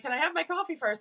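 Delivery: high, whiny plea for coffee; mock plea. Medium begging spirits to let her have coffee, high whiny plea. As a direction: beg for a coffee break in a high voice. Her groggy retort, acted.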